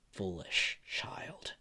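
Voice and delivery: slow, hushed, low contempt; menacing whisper. Acted. Endeavor to Izuku as he walks toward him, quietly menacing. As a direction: draw it out slowly, almost whispered. Villain sneering at the kid.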